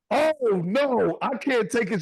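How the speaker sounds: high, loud fed-up wail; mock outburst. Aunt at a graduation ceremony, exasperated outburst. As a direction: imitate an aunt breaking out in protest. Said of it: "She just breaks out".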